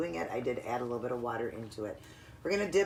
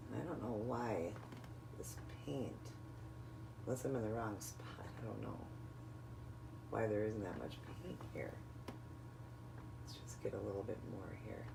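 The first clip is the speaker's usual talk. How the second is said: muttering to herself; 4 semitones lower, 16 dB quieter than usual. Real, not acted. Muttering to herself over paint.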